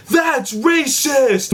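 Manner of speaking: high, very slow, drawn-out mock outrage; mocking accuser. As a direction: parody the accusers, stretching it up high. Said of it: "whoever's calling out".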